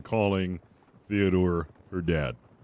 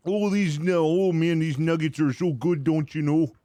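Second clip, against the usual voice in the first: put-on Wisconsin accent, pitched up, savoring nuggets; 8 semitones higher than usual. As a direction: relish the food like a Wisconsin local. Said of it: "Wisconsin accent".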